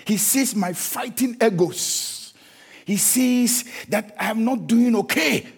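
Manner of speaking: slow, weighty litany; slow, measured sermon list; slow preaching. Acted. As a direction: lay out each line slowly.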